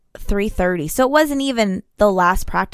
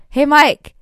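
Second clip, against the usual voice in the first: re-enacted call-out, higher and louder; retold yell; 8 semitones higher, 6 dB louder than usual. Spotting a fellow rider across the way, retold yell. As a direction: call out to get his attention. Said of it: "I just look and I yell".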